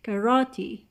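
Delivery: "karate" with a loud second syllable RA; exaggerated stress. Shown as a demonstration. Teacher showing class the stress: "karate" with RA loud. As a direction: punch RA in "karate".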